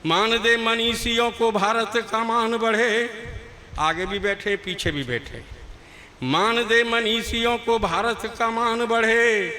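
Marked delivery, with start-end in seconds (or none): 0.1-3.1 louder, slow poetic declamation; slow, louder declaimed poem line; declaimed verse. Acted. 6.2-9.5 louder, slow poetic declamation; slow, louder declaimed poem line; declaimed verse. Acted.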